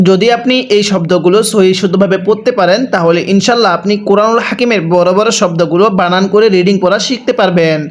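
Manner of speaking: normal talking voice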